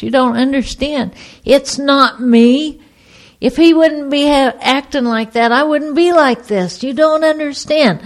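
high-pitched, insistent self-justifying plea; pleading protest. Retold or imitated. Diner being thrown out, blaming her husband to the waiter, pleading.

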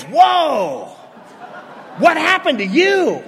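high, drawn-out astonished "whoa"; gasp-it-out alarm, stretched and high; shocked reaction. Retold or imitated. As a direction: play the stunned coworker. Acts out alarmed coworker's reaction.

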